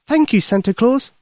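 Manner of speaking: put-on high, eager young girl; little girl voice. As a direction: speak like a worried little girl. Lucy thanks.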